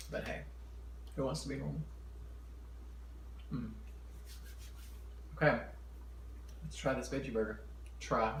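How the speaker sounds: ordinary talk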